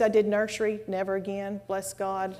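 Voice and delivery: ordinary talk, nothing marked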